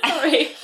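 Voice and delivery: coughing fit then laugh. Real, not acted. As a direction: cough and giggle after choking. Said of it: "choking on my crisps".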